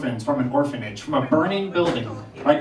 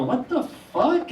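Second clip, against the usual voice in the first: mock shock; 8 semitones higher, slower than usual. Shocked at naked dad.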